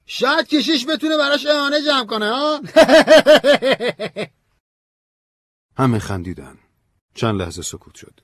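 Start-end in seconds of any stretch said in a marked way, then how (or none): 0.1-2.6 very high, loud, jokey character voice; high-pitched teasing heckle; joking. A narrator's reading. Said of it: "یکی از مهمونا به شوخی گفت". 2.6-4.2 high, loud, hammed-up laughter; big high-pitched guffaw; loud cackle. Guest laughs at own joke.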